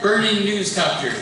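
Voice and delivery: normal talking voice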